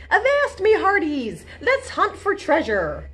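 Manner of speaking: high, loud hearty pirate call; rally the crew like a pirate captain. Acted. Captain Izzy calling her crew to hunt treasure, hearty.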